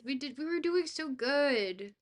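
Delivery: normal talking voice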